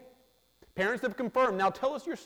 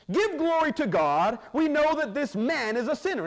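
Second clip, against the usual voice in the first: stern Pharisees; 8 semitones higher, 5 dB louder than usual. Acted. Pharisees ordering the healed man, stern command.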